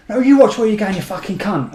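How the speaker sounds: angry retort, punched high and loud at the start; acting out an irate driver's comeback; retold road rage. Driver telling off an old pedestrian, irate retort. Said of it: "I've just got instant road rage".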